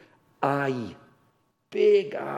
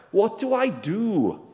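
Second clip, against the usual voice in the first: slow, high, loud question; lightly acted plea, drawn out and raised; acted appeal; 5 semitones higher, 6 dB louder, slower than usual. Rich man asking Jesus for eternal life, drawn-out raised appeal.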